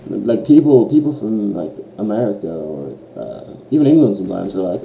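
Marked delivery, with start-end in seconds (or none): none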